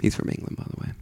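whispered aside; whispered gossip to cohost; mock whispered aside. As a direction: lean in and whisper it. Mock aside repeating host.

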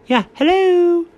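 put-on light British-accented hello; a breezy British hello; a hint of British accent. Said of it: "don't use my British accent when you say hello".

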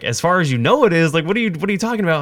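a cop mocking the odd answer, incredulous. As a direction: throw the words back at him, baffled. Cop throws his answer back.

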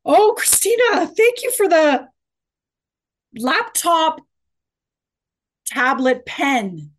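high, loud, slow gushing thanks; excited. Pretend. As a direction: gush over a surprise gift. Pretend gift-opening improv game.

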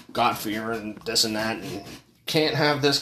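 ordinary delivery

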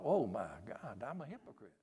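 very soft, sinking realisation; hushed dismay. Retold or imitated. Preacher retells realising his hypocrisy to God, hushed dismay. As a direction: let it drop to almost nothing.

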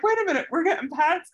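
lightly voiced dog who spots the fun; voicing the dog. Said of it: "the third one was like".

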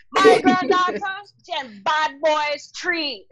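high, loud start then slow Chinese Jamaican grandma brag; grandma's accent. Retold or imitated. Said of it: "that's how she brags".

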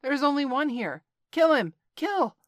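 high, loud screaming cry to kill; monkey shouting. A narrator's reading. Monkey rallying the troop to attack, shrieking. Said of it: "A monkey shouted".

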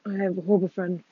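normal talking voice

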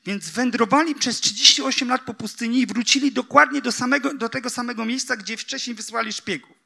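high, loud, driving sermon voice; fervent sermon delivery; preaching at full force. Acted. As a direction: push the point home to the congregation. Preacher builds intensity.

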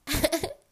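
loud high laugh; burst of laughter. Real, not acted. About people throwing bad energy, loud laugh.

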